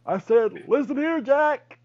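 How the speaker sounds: high, loud laughing Biden; Joe Biden impression. Friend voices Biden while laughing hard. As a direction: crack up mid-impression. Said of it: "I'm Joe Biden".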